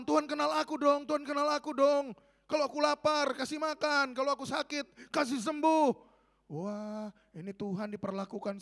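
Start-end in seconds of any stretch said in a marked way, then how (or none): 0.0-5.9 high, insistent pleading; mock demanding prayer. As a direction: badger God like someone giving orders. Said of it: "Jangan kita paksa Tuhan". (tiruan doa memaksa dengan nada tinggi)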